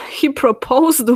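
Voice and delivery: ordinary talk, nothing marked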